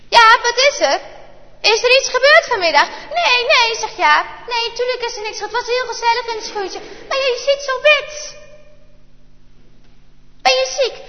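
mother checking on her son; worried mother. A narrator's reading. Mother worries about pale Jaap. (moeder vraagt bezorgd en hoog aan Jaap)

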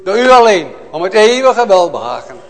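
loud, high, impassioned preaching; fiery preaching. Acted. Preacher to his congregation, a loud fervent cry. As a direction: proclaim it to the whole church with full fire.

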